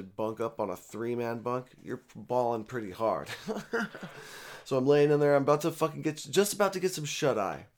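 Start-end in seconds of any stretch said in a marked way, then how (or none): 3.3-4.6 breathy laugh. Real, not acted. Laughing at own jail story.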